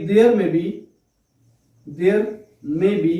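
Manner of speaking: word-by-word dictation with long pauses; slow dictation. Acted. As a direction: dictate slowly for note-takers, repeating phrases. Dictates definition for note-taking. (धीरे-धीरे, रुक-रुक कर श्रुतलेख)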